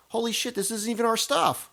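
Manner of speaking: high-pitched comic dismay; mock shock. Band discovering the CDs are wrong, acted shock. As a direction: blurt it in hammed-up disbelief. Host acts band's shock, imagined scene.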